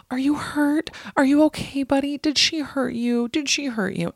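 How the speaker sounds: put-on high, fussing baby talk to the cat; mocking worried boyfriend. Boyfriend fussing over the cat in a high, doting voice. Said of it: "And he's like".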